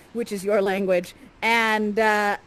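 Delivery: usual speaking voice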